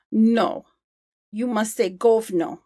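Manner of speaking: Russian accent, firm correction; Alexei. Shown as a demonstration. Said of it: "Alexei, who was one of the pair skaters".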